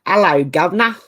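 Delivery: English accent, H dropped from Hello; English accent attempt. Shown as a demonstration. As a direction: try a chirpy Cockney-style English greeting, 'ello. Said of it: "That might work a bit better".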